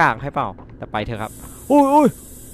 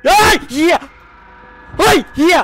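high panicked shouts while fleeing; terrified screaming; 4 dB louder than usual. Real, not acted. Player chased by the monster in the game, terrified screaming. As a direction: scream in real terror as it chases you.

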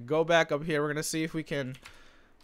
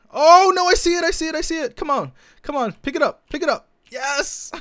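high, loud and urgent, willing the drop to land; excited yelling; 13 semitones higher, 10 dB louder than usual. Real, not acted. Gamer urging a spawner drop out of the lava, excited yelling. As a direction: come on come on, higher and louder each time.

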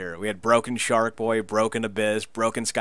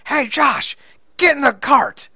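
high, loud barking call to a partner; Scott Steiner shout; 10 dB louder, slower than usual. Retold or imitated. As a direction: yell at Josh to jump in the cart. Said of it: "Scott's like".

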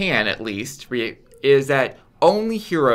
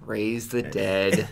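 sheepish mumble; 4 semitones lower, 4 dB quieter, much slower than usual. Retold or imitated. Repeated sheepish reply.

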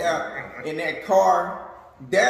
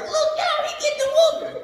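put-on much higher kid voice calling out; gleeful little kid; faster than usual. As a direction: crow like a little kid tattling. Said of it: "it was like".

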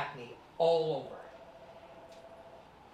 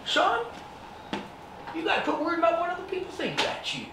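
a higher, fast fatherly lecture, voiced by the son; imitating dad; 10 semitones higher, faster than usual. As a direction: play dad talking his son down, quickly. Dad's advice voiced in story.

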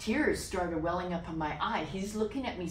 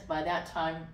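normal talking voice

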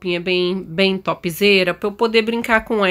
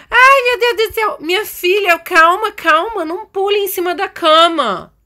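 high, urgent pleading to calm down; hammed-up motherly panic, pitched high; alarmed mother; 10 semitones higher, 3 dB louder than usual. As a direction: cry out to stop an overexcited child. Mother stops daughter jumping on bed.

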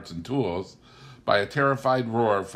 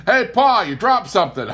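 high, loud mocking call; calling out teasingly; 11 semitones higher, 9 dB louder than usual. A narrator's reading. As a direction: holler across the yard. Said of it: "Elwin called from under his car".

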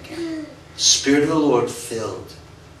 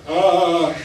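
short, higher astonished "Ah"; stunned gasp; 5 semitones higher, 4 dB louder than usual. Retold or imitated. [name] amazed that the pastor knows his prayer, gasping. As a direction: gasp as if caught out. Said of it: "By then, I was like".

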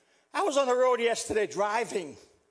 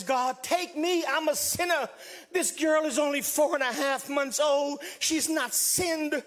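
retold desperate plea, pitched up; pleading; 5 semitones higher than usual. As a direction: plead with God to take you in a child's place. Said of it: "I bargained with God".

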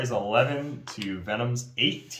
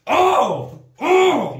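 high, loud gasping "oh"s; excited gasps; 8 dB louder than usual. Real, not acted. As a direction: gasp as the roll lands. Tense dice roll, success.

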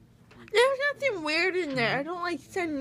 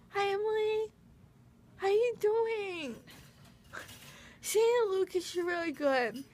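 ordinary talk, nothing marked

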